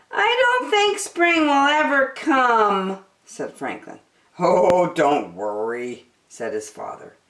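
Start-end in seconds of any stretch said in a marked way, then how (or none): 0.1-3.0 put-on higher childlike voice, discouraged; a small boy giving up hope; glum young turtle. Franklin complaining to his father, childlike gloom. 4.4-6.1 slow, lower fatherly comfort; reassuring father. Acted. Father comforting Franklin, slow and reassuring.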